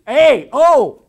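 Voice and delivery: a real loud, high yelp at the failed try; excited outburst. Real, not acted. Trying to split an apple before the class, loud excited yelp. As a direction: cry out as the apple slips.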